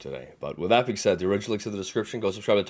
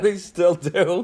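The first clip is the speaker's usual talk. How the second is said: laughing; 10 semitones higher, 3 dB louder, much slower than usual. Real, not acted. Laughing at the hot tub section.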